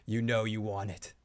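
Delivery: low, soft coaxing voice; Joe. Acted. Joe egging the host on, low and coaxing. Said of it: "somewhere Joe is like".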